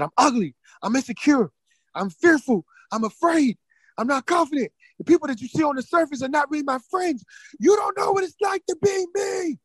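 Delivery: high anguished cry, building to a wail. Retold or imitated. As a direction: cry out each admission, climbing to a scream. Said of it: "I was crying this out".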